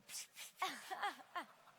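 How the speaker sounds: a sudden, high real laugh; high burst of laughter. Real, not acted. Laughing at the story's punchline.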